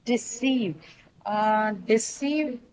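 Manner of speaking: deceive stressed on its second syllable; slow demo of deceive, weight on -ceive. Shown as a demonstration. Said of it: "carry the stress on the second syllable".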